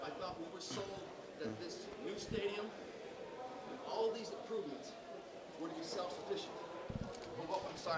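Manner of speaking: breathless after running, out of breath; panting words after a run. Real, not acted. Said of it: "I'm out of breath. I had to run over here".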